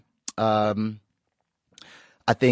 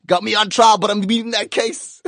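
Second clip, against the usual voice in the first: high-pitched, hyped-up quoting; over-excited outburst; 10 semitones higher, 5 dB louder than usual. Pretend. Host riffing on the charges, over-excited. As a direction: burst out high and gleeful. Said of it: "got a bit excited over there".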